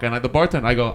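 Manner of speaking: normal talking voice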